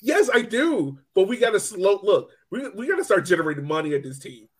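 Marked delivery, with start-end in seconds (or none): none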